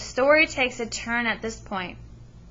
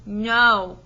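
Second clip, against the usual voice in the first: a loud, wailing cry of no; 10 dB louder than usual. Acted. Mother refusing her son's offer to quit school, loud wail. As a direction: let the mother's no burst out loud. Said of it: "the mama is wailing".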